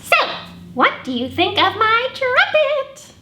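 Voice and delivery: a bossy eager little pig, bursting; Piggy. Acted. Librarian voicing Piggy showing off a trumpet to Gerald.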